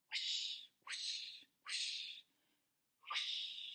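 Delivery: soft breathy wiper swishes, no voice; whispered whoosh sounds. Acted. Imitating windshield wipers.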